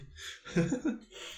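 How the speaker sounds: breathy giggle. Real, not acted. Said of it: "je sais plus parler".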